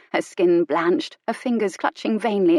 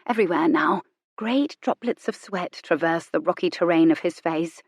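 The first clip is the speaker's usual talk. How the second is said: plain reading voice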